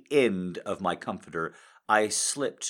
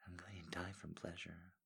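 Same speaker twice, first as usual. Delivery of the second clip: whisper; 21 dB quieter than usual. Acted. Lover whispering in ecstasy.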